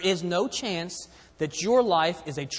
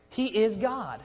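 normal talking voice

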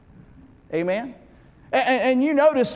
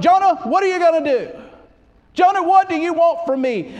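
God begging; 9 semitones higher, 7 dB louder than usual. Acted. God begging Jonah, raised voice.

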